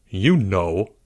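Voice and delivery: drawn-out, condescending explanation; mildly patronizing, slowing at the end. A narrator's reading. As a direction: explain it as if to a slow friend. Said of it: "Albert explained condescendingly".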